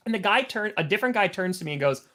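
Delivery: ordinary talk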